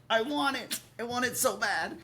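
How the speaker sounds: high, mock-desperate plea; whiny wanting. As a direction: beg like a greedy kid. Acting greedy wanting.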